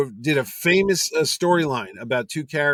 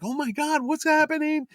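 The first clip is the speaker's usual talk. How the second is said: very high-pitched mock panic; retold alarm; 14 semitones higher than usual. Organizer hearing the writer threaten a punch, acted high alarm. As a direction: act out bewildered alarm, pitch way up. Retelling his alarmed reaction.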